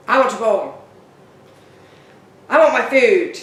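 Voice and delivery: a gruff dog who has no manners; rude demanding dog. Pretend. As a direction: bark out the demands like a grumpy dog. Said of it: "not sounding so angry and so gruff".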